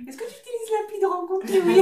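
high, fast, sing-song question to a rabbit; playful pet voice. Pretend. Owner jokingly asks her rabbit Kiwi a question, high playful voice. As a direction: coo at the bunny like an interviewer.

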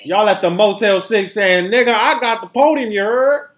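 loud, high mock brag; mock rioter bragging. Host mocking a rioter bragging about the stolen podium, loud. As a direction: ham up a rioter showing off his loot.

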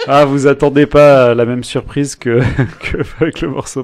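talking through a laugh; words breaking into laughter. Real, not acted. Laughs through his own joke.

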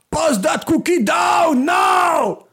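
high, slow shout with a put-on accent; shouted movie quote. Quoting Schwarzenegger yelling down the phone at the neighbour, a slow high shout. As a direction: bellow it slowly like the action star. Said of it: "il dit à son voisin".

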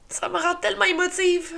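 tearful, voice breaking; crying. Real, not acted. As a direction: speak through real tears. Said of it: "moi qui pleure de même". (en pleurant, la voix qui se brise)

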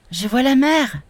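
put-on a child at the window, amazed; excited boy. A narrator's reading. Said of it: "dit-il". (l'aîné à la fenêtre, émerveillé)

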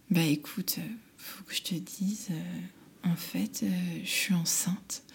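soft, hushed confession; quiet. Retold or imitated. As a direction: confide it quietly and hesitantly. Confiding pregnancy to colleague. (aveu chuchoté à sa collègue)

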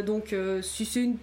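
usual speaking voice

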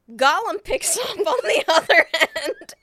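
words breaking into real laughter; storyteller losing it to laughter; laughing through it. Real, not acted. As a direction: tell it while cracking up. Laughing while telling phone reveal.

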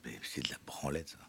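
whispered scoff; whispering. Real, not acted. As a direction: breathe it out as a scornful aside. Dismisses therapy under breath.

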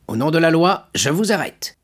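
high, firm official's voice; stern commissaire. Acted. Commissaire répondant fermement à monsieur Morel. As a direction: play the police commissary, stiff and formal.

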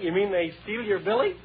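usual speaking voice

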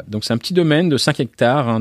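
ordinary talk

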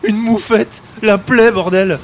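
loud, fed-up complaining; grumbling outburst. Acted. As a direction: vent your frustration. Complaining about skunk body.

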